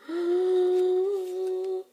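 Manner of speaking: sharp high gasp. Real, not acted. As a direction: gasp in suspense. Reaction to show ending.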